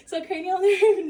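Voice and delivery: giggling through the words; breaking into a laugh mid-sentence. Real, not acted. Giggling mid-narration during exam.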